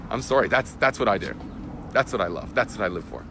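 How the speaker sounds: usual speaking voice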